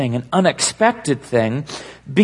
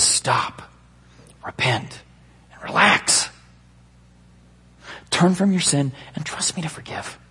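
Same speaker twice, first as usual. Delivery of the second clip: half-whispered, with long pauses; hushed, breath-heavy appeal; gentle divine plea; 3 semitones higher, 4 dB quieter than usual. Acted. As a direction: plead softly, word by word. God's gracious plea quoted, hushed.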